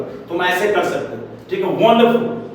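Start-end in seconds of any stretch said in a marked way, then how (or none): 1.9-2.5 higher, showy exclamation; demonstrative, high-pitched cheer; exaggerated happy praise. Shown as a demonstration. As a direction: overdo the delight. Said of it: "लेकिन ऐसे बोलना नहीं".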